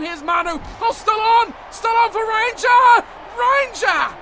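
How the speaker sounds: fast rising commentary call, loud and high; rugby commentator hitting the peak of a try; excited play-by-play. Acted. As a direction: call the try as it happens, voice climbing.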